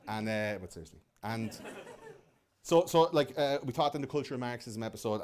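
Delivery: normal talking voice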